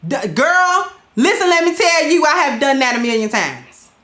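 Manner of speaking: normal talking voice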